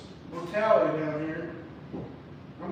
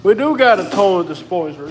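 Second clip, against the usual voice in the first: loud complaining; 10 dB louder than usual. Real, not acted. Complaining loudly about the flood.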